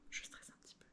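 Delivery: whisper. Real, not acted. Nervous aside to camera.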